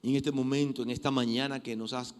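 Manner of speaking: hushed heartfelt prayer; closing prayer. Acted. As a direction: pray humbly for the church.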